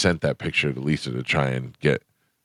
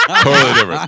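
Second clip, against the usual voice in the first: laughs it out, high and loud; laughing; 11 semitones higher, 8 dB louder, much faster than usual. Real, not acted. Laughing off the host's joke, high and loud. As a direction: burst out laughing through the words.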